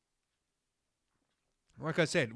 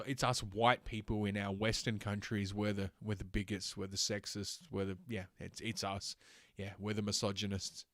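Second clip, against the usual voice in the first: sarcastic; 4 semitones lower, 6 dB quieter than usual. Acted. Sarcastic mock confession of guilt.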